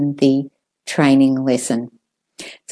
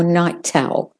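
"night owl" in British RP, with a clear aspirated T kept apart from "owl", a little slower; a careful RP "night owl" with a crisp T; 5 dB quieter than usual. Shown as a demonstration. Teacher contrasting the British RP "night owl" with a crisp T. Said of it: "if you're doing the received pronunciation".

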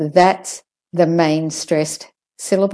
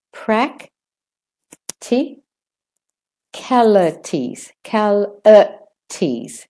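syllable by syllable; 3 semitones higher than usual. Shown as a demonstration. Teacher demonstrates for learners, "Practicalities" in separate syllables. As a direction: break "Practicalities" up, piece by piece.

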